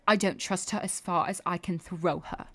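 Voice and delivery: light imitation of a British Traitors contestant; mock British accent. Mimicking British reality show contestants, mild British accent. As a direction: repeat it in a faintly British accent. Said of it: "I just repeat what they say every time 'cause their accents".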